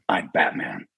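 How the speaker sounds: low, narrow, direct hero voice; short and direct, pitched well down; Batman. Shown as a demonstration. Actor demonstrates his superhero voice, low and direct. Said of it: "it's pretty narrow. Um, it's, it's very direct".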